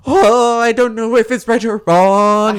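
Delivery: mock ditzy voice. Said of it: "be like".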